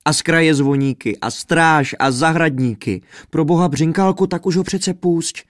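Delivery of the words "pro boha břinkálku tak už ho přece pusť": higher, urgent begging; pleading princess. A narrator's reading. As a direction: beg him to let her father go. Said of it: "prosila Světluška".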